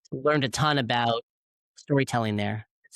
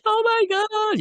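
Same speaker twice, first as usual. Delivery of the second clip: much higher, louder mock squeal of emotion; imitating an overwhelmed gasp; 6 dB louder than usual. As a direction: play the wife bursting into tears, squeaky and high. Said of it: "Danielle just starts crying".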